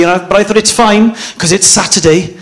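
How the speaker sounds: ordinary delivery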